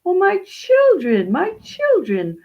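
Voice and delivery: high, loud, swooping welcome; high-voiced widow, thrilled by visitors; delighted old widow. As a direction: greet them like an overjoyed old lady.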